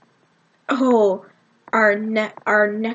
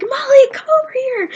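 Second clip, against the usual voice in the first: high, loud excited call; 6 dB louder than usual. Retold or imitated. Group calls Molly over to join them, calling out. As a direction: beckon a friend over from across seats.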